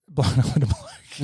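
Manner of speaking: slow, laughing repeat. Real, not acted. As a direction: crack up over your own words. Cracks up at own confession.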